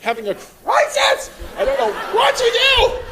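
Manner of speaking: high, hammed-up mock wail; complaining girl. Comic imitates a girl complaining of a crisis, mock complaining. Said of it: "a girl the other day just complaining".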